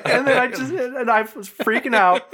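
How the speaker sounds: breathless high laughter; high laughing. Real, not acted. Laughing after mock panic.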